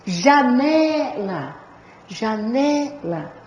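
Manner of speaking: "janela" very slowly, NE drawn out; stressed syllable demo. Shown as a demonstration. Teacher giving paroxytone examples, stretched NE in "janela". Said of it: "Então, se eu disser".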